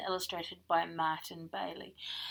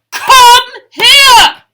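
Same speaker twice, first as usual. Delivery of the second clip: furious giant's scream, much higher and louder; roar; 13 semitones higher, 24 dB louder than usual. Acted. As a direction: scream it at the top of your lungs. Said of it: "he roared".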